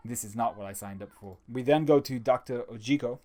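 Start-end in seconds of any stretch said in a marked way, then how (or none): 0.1-1.4 mock regret. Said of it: "looking back with complete regret".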